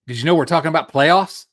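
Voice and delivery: loud, incredulous question; mock sports rant. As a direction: imitate a coach's outraged question. Imitating a viral sports rant.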